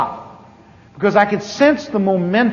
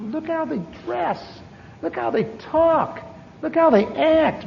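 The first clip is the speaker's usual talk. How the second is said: mocking disdain; 7 semitones higher, 6 dB quieter, slower than usual. Mocking the church people.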